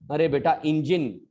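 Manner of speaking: ordinary delivery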